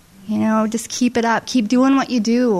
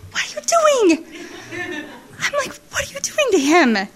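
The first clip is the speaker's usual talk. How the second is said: high, cracking laugh-cry; 10 semitones higher than usual. Retold or imitated. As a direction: ask it while half laughing, half crying. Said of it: "laugh cry".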